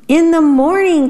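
high, bright group answer; cheerful cry. A narrator's reading. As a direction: call it out happily like a chorus of beetles. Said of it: "cried all the other beetles".

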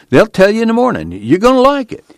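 high-pitched, eager promise; happy teasing. A narrator's reading. Son promising his mother good news, excited. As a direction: tease with rising delight. Tom happily teases Ma.

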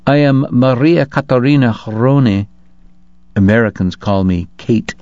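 cultivated Hungarian lady introducing herself; lightly regal Hungarian-accented hostess. A narrator's reading. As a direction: introduce yourself graciously with a Magyar accent. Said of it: "charmingly accented by Magyar".

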